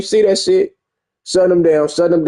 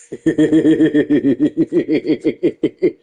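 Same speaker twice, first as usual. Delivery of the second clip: laughing. Real, not acted. Laughing at the game.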